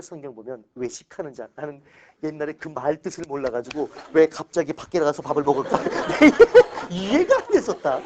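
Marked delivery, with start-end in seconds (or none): 5.8-8.0 high, loud laugh at himself; cracking up, much higher and louder. Real, not acted. Laughs at his own confusion.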